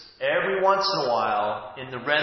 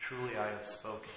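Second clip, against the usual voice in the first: a soft, much quieter repeat of "Truly I have spoken"; hushed echo; 4 semitones lower, 12 dB quieter than usual. Shown as a demonstration. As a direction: let "Truly I have spoken" fall away in a hush.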